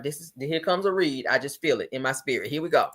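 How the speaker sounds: ordinary talk, nothing marked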